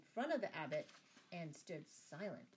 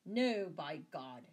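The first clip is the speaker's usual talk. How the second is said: loud, slow, angry oath; shouted; 9 dB louder, slower than usual. A narrator's reading. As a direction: thunder the refusal slowly. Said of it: "shouted the abbot".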